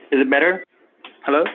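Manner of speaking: normal talking voice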